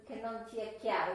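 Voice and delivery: ordinary talk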